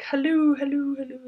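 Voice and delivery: a mock echo ringing on and on; imitated echo. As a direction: call it out like your voice bouncing back. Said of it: "and it was like".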